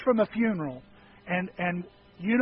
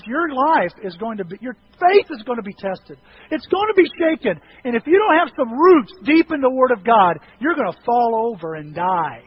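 ordinary talk, nothing marked

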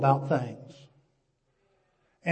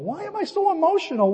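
high-pitched put-on upset; hammed-up bewilderment at welling feelings, voice jumping high; mock emotional; 11 semitones higher than usual. Man overcome at Lambeau Field, mock emotional. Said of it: "I'm just emotional".

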